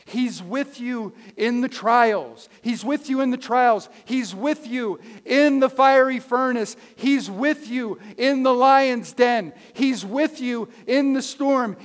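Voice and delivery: loud, high, slow rhythmic proclamation; impassioned preaching. Real, not acted. Pastor assuring the church God is with them, preaching forcefully. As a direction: drive each refrain home at full voice.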